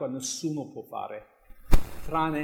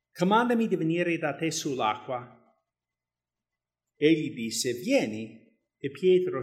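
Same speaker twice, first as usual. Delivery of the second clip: raised, louder Bible reading voice; scripture reading; 3 semitones higher, 7 dB louder than usual. Acted. Preacher reading the gospel to the church, raised reading voice. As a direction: read the passage out to the congregation. Reads Matthew 14 aloud.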